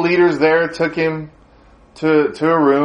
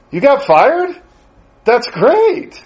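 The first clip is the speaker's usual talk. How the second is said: high, loud, gleeful delight; excited; 9 semitones higher, 3 dB louder, slower than usual. Retold or imitated. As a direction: burst out with happy surprise. Young preacher hears of firing.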